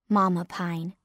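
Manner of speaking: ordinary delivery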